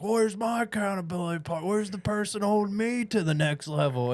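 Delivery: mock whiny complaint; mock whining. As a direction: parody your own griping. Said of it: "I bitch and I complain, like".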